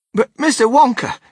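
high, loud protest of disbelief; loud, high-pitched astonishment; astonished stammer. A narrator's reading. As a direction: burst in, amazed old grandpa. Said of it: "stammered Grandpa Joe".

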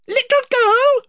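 high, proud little mouse knight; Reepicheep. Acted. Reepicheep indignant.

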